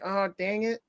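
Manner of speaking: usual speaking voice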